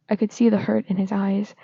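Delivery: ordinary delivery, nothing marked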